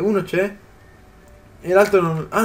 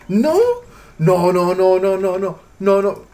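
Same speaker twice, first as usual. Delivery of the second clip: panicked no; 3 semitones higher, 5 dB louder, much slower than usual. Real, not acted. Raptor attacking, panicked cries.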